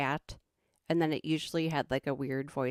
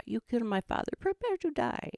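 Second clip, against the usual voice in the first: mock-dramatic film swordsman; Inigo Montoya; 7 semitones higher than usual. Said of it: "Indigo Monti-, yes, Inigo Montoya".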